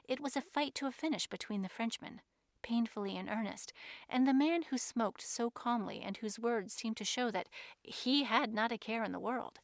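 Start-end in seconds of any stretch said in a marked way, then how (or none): none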